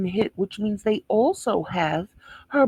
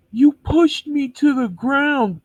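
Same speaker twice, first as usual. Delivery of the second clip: higher, louder and slow, a whiny mock of her; mocking whiny victim; 7 semitones higher, 6 dB louder than usual. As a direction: parrot her complaint in a sarcastic, high whine. Mocking the woman's complaint.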